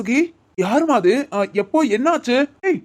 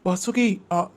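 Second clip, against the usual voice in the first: ordinary delivery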